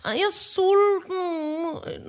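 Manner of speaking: slow, drawn-out whine; mock child complaining of hunger; whiny kid. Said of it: "og så sådan her".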